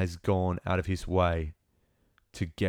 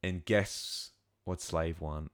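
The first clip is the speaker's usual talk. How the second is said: ordinary talk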